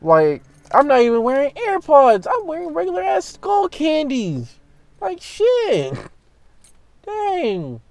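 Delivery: high, strained disbelief; exasperated. Real, not acted. Narrator ranting about the lady and his Skull Candies, high exasperated voice. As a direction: squeak it out, fed up.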